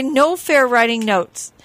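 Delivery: ordinary delivery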